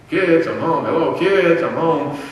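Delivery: put-on loud, high call to kids who don't answer. Calling louder, kids ignore him.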